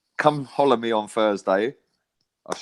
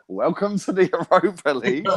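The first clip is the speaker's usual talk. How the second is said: laughing; 7 semitones higher than usual. Retold or imitated. Mock welcome, laughing.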